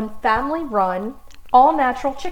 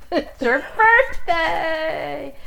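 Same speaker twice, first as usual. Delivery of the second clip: slow, drawn-out glee with a laugh; birthday surprise; 6 semitones higher, much slower than usual. Real, not acted. Host springing a birthday surprise on her friend, gleeful. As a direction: stretch it out delighted.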